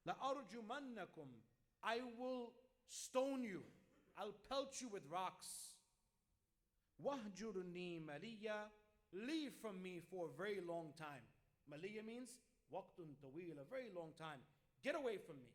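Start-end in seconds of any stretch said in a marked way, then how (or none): 0.1-11.2 louder, menacing father threatening his son. Acted. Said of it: "his father, what is the reply". 14.8-15.5 loud, dismissive "get away from me"; angry father. Acted. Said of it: "he told his son".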